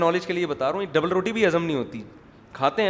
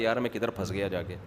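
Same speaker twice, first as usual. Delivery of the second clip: regretful mutter; 8 semitones lower, 7 dB quieter than usual. Retold or imitated. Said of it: "اس کو یہ لگ رہا ہوتا ہے".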